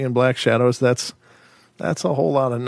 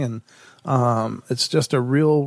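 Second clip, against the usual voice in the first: ordinary talk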